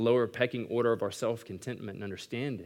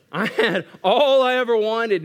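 loud, high, drawn-out declaration; overstuffed exclamation; 10 semitones higher, 10 dB louder, slower than usual. Retold or imitated. Boy stuffed with his mom's biscuits, loud stretched-out exclamation. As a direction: call it out high and slow, stretching the words.

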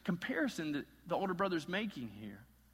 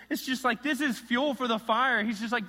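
ordinary talk, nothing marked

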